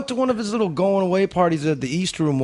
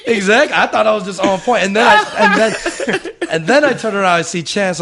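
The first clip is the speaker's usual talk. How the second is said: laughing while talking, higher up; 7 semitones higher, 7 dB louder than usual. Real, not acted. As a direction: talk through real laughter. Laughing while retelling the story.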